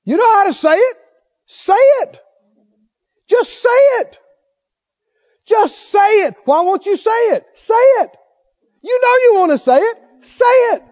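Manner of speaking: high, loud, insistent tempter; goading devil. Retold or imitated. Devil tempting Jesus to speak, high insistent goading. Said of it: "And the devil said".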